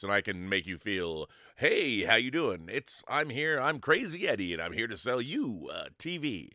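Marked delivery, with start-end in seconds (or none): 1.5-6.5 booming huckster voice; loud pitchman voice. Shown as a demonstration. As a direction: sell it like a TV ad announcer. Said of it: "I can do different things with my voice".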